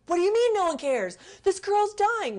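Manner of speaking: high, loud, frantic disbelief; panicked yelling. Retold or imitated. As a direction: shout it at him, desperate to save the girl. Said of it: "And I was like".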